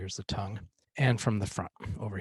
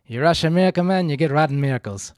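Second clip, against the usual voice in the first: louder, higher film-character voice; Miracle Max quote; 5 semitones higher, 8 dB louder than usual. Acted. Coach quoting the film, played loud and in character. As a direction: bark the line like the old miracle man.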